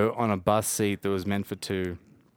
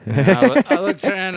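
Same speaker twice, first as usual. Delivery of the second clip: surprised; 11 semitones higher, 11 dB louder than usual. Real, not acted. Shocked on the bus.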